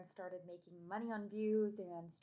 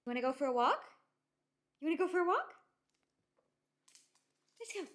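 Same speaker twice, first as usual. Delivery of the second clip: fast, loud, high puppy talk; 9 semitones higher, 9 dB louder, faster than usual. Real, not acted. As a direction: get the dog hyped for a walk. Asking dog about walk.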